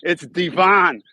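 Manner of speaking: loud, emphatic Devon as dee-VON; insistent Chicago pronunciation. Shown as a demonstration. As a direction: punch the second syllable of Devon. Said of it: "that's my rant on that".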